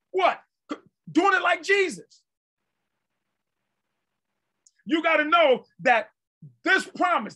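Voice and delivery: emphatic, building declarations with stretched key words; fervent preaching. Real, not acted. Preacher proclaiming the answer to life's troubles, fervent preaching. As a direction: hammer each repeated phrase at the congregation.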